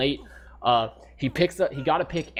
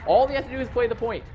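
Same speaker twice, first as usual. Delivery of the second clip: high, fast, frantic plea to the team; exasperated caster urging, much higher and faster; desperate pleading; 11 semitones higher than usual. Real, not acted. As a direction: voice climbing high as you beg them to touch the point. Caster begging team to play objective.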